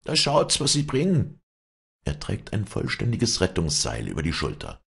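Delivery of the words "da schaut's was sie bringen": high, loud eager call. Acted. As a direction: call the crowd over to see. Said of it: "rufend und winkend". (aufgeregtes Rufen)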